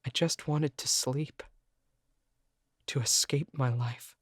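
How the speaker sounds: plain reading voice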